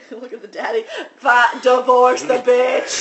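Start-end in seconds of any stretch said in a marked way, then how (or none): 1.2-2.9 slow, louder urging; hammed-up heckle; mock advice to dad. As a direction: drag it out like egging him on. Voicing the daddy.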